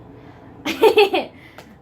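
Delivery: loud, high real laugh; burst of laughter. Real, not acted. Vlogger cracking up about her gloomy teacher, loud laugh.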